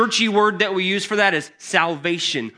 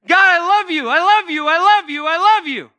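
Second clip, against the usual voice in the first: much higher and louder, repeated cries of love; excited devotion; 12 semitones higher, 7 dB louder than usual. Retold or imitated. Believer crying out love to God, high and loud. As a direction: pour it out to God, overflowing. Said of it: "And I get excited and I go".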